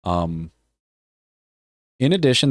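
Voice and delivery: ordinary delivery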